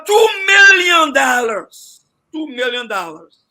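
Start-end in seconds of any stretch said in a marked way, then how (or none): 0.0-2.0 much louder, higher and very slow, each word hit hard; emphatic outcry. Real, not acted. Panelist shouting the wasted total at the host. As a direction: make the sum sound scandalous.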